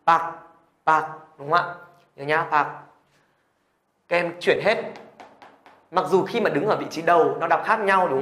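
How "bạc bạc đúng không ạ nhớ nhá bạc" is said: "bạc" repeated as a model; đọc mẫu. Shown as a demonstration. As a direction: stop each "bạc" on a final k. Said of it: "Đọc là gì ạ". (đọc mẫu "bạc", âm k cuối)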